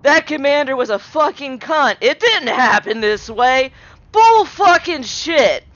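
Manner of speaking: imitated ranting, rising in pitch and volume; imitating his screaming. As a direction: act out his screamed protest, building to a drawn-out curse. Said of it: "as he's screaming".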